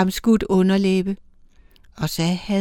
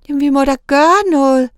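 higher, louder and fast, close to panic; desperate; 6 semitones higher, 4 dB louder, faster than usual. A narrator's reading. Young angel begging the elder to act, desperate. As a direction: plead that something must be done. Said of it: "sagde den yngste fortvivlet".

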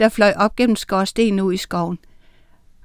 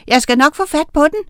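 small, high, quick voice; high, eager assurance; little witch promising; 7 semitones higher, 3 dB louder, faster than usual. A narrator's reading. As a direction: promise briskly as a tiny witch. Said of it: "lovede hun".

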